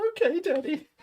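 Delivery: put-on high little-girl voice; high meek child's voice answering her dad; imitating his daughter. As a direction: mimic a small child meekly agreeing. Voicing his little daughter's reply.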